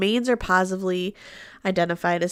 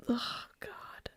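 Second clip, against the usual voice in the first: exasperated whisper; 4 semitones higher, 17 dB quieter than usual. Real, not acted. Exasperated aside.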